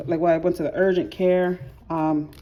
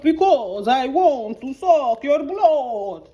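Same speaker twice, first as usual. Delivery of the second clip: slow, higher, louder mock Transylvanian Dracula accent; Count Dracula accent; 7 semitones higher, 4 dB louder, much slower than usual. Mom teasing her daughter in a Dracula accent. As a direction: ham up a Dracula accent, stretching every word. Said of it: "the Count Dracula accent".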